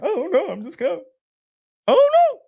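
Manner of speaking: loud, high-pitched fake bawling; mock crying. Comic playing the boxer who can't explain his tears, mock wailing. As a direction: wail it like a hammed-up crybaby. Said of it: "something makes you cry".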